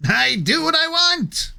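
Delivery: high nasal cackling villain; Skeletor voice. Pretend. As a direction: sneer it like a cartoon villain. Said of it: "The true classic villain voice".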